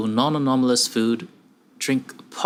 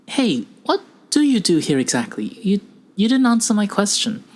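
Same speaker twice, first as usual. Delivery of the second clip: higher, pressing, questioning voice; unsettled junior researcher; 8 semitones higher than usual. Acted. Junior researcher challenges evasive stranger, unsettled and insistent. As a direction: press for an answer, pitched up.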